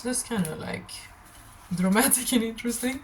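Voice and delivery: ordinary delivery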